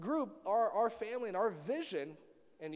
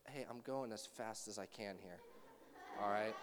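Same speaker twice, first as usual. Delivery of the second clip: 1 mock-calm slowpoke; 8 semitones lower, 8 dB quieter than usual. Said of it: "I slow down".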